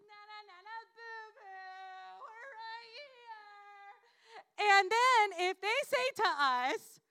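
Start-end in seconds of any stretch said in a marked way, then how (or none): 0.1-4.7 taunting. Acted. Taunting enemies from the valley.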